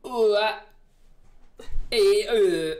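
loud, high U, E, I in exaggerated foreign vowels; imitating Swedish vowels. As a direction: belt out a learner's try at Swedish U, E, I. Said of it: "do I sound like a hick?".

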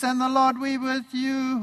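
mock-solemn intoning of the liturgy; priest intoning. Priest greeting the congregation at mass, lightly intoned. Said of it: "The priest says".

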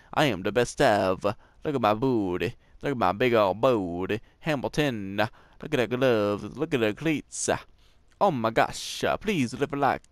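low-pitched put-on character voice; put-on silly voice. Player asking viewers for likes at the end, silly low put-on voice. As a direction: drop the voice well down for a goofy sign-off. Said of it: "so why am I talking like this?".

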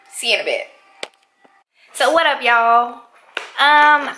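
ordinary delivery

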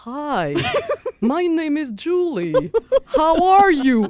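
self-mocking, drawn-out phone introduction in English; mock beginner English. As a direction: recite it like a lost schoolkid's first phrases. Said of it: "on dirait, je sais pas quoi dire".

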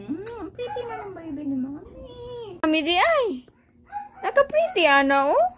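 high cooing praise to a pet. Real, not acted. Praising pet in baby talk.

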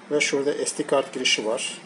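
usual speaking voice

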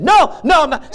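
high, loud driver shout; retold shout. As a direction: bellow no, no like the angry driver. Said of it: "So he's yelling".

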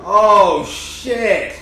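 loud, high exclamation of real surprise; shocked outburst. Real, not acted. Viewer reacting to a twist in the show, shocked shout.

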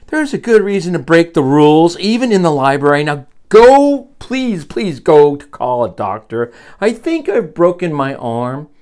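high, loud plea for help; urgent pleading. Acted. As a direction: beg for a doctor from the floor. Hurt librarian urges a doctor.

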